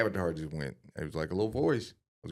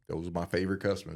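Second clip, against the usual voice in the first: ordinary delivery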